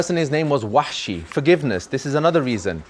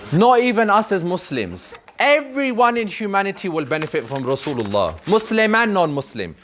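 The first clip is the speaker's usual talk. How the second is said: impassioned preaching; 6 semitones higher than usual. Acted. Preaching with rising force.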